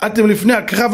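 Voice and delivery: normal talking voice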